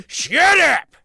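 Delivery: sudden high-pitched comic yell; Adam Sandler. Acted. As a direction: shriek it out loud, out of nowhere. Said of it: "Next up, Adam Sandler".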